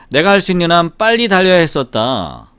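usual speaking voice

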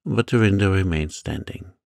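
plain reading voice

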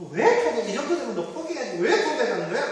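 high, laughing mock interrogation; villains pressing. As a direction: press him like a sneering villain. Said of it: "이 악당들이 그렇게 다그칩니다". (악당이 다그치는 말투)

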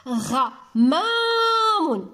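غمام with a very long held ما; slow model word for children; stretched syllables. Shown as a demonstration. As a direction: draw out the middle syllable on a level pitch.

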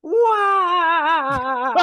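long, high, loud wail; hammed-up cartoon scream of pain. Crashed racer in the game cries out, high wailing scream. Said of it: "like, a scream of pain".

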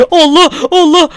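high, loud gasping surprise; startled by a sudden blast, high and loud; startled exclamation. Real, not acted. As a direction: gasp as the bomb goes off. Bomb explodes nearby.

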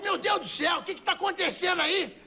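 high-pitched alarmed outcry; acted alarm. Madam running upstairs to the screaming, high acted alarm. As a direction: panicky question, pitched well up.

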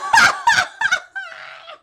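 loud, high, shrieking laugh; helpless laughter. Real, not acted. Reader cracking up at the Maki mix-up, shrieking laughter. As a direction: burst into uncontrolled high laughter.

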